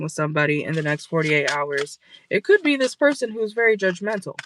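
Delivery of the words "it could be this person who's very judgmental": ordinary talk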